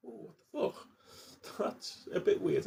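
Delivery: breathless half-whispered disbelief, slow; shocked mutter. Retold or imitated. Stunned reaction quoted to self.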